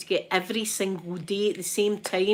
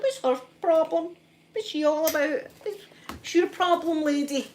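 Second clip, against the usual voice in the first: high, sassy put-down; mocking the shouting woman in the car park, high and sassy; sassy indignation; 8 semitones higher than usual. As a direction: put the rude lady firmly in her place. Mock outrage at the woman.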